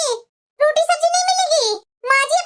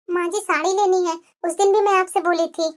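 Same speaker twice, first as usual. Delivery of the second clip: lower, timid voice of a downtrodden wife; meek elder daughter-in-law; 7 semitones lower than usual. Acted. Elder bahu to younger sister-in-law, meek and resigned. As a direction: sigh it out like a bullied bahu.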